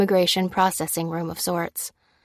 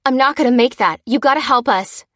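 loud, raised-pitch appeal; insistent, higher and louder; urgent plea; 6 semitones higher, 7 dB louder than usual. A narrator's reading. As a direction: press someone desperately for help. Pleading for help during CPR.

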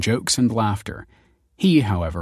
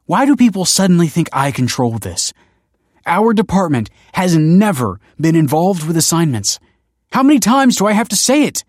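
raised, loud, frustrated protest; exasperated anger; 10 semitones higher, 8 dB louder than usual. A narrator's reading. Wife arguing she controls nothing, exasperated. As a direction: build to a high exasperated demand.

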